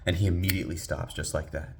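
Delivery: ordinary delivery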